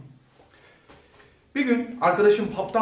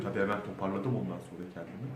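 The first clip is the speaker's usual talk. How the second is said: ordinary delivery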